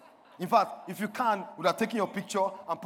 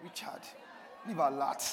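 low, softer self-address; said to oneself; 5 semitones lower, 5 dB quieter than usual. Shown as a demonstration. Preacher showing how to say it to yourself, low and personal. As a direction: charge yourself privately, by name. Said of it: "say it to yourself".

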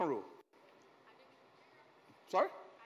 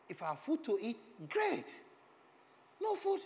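excited; 7 semitones higher, 4 dB louder than usual. Real, not acted. Preacher builds excitedly.

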